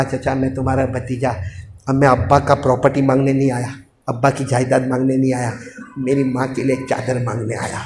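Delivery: put-on soft, low small boy asking; timid boy. Nephew humbly asking uncle for a shawl, soft and timid. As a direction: ask meekly, almost under breath.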